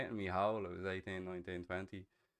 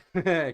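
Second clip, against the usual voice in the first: burst of laughter; 9 semitones higher, 14 dB louder than usual. Real, not acted. Laughs mid-phrase.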